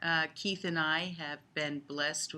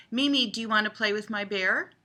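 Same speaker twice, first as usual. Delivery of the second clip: imitating little girl; 5 semitones higher, 7 dB louder, faster than usual. Voicing granddaughter in the game.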